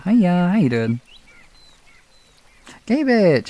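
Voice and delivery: normal talking voice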